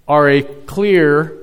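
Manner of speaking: slow down and lean hard on clear; stress on clear. Shown as a demonstration. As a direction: clear drawn out slowly and leaned on. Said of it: "emphasis on the word clear".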